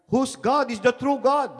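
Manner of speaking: high-pitched mock dispute; arguing. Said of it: "they were arguing".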